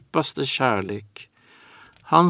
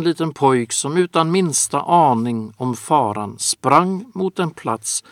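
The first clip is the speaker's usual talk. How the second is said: ordinary delivery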